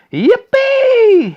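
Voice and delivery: very loud, high whoop; explosive yell. Acted. Grandpa Joe leaping out of bed, very loud high whoop. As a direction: explode with joy. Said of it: "he shouted".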